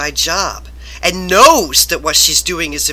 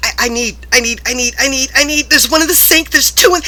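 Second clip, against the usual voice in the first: rising panicked stutter, much higher; stammering, climbing higher and louder; flustered stammer; 7 semitones higher, 4 dB louder than usual. Retold or imitated. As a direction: trip over the words, rattled. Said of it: "she stressed me out even more".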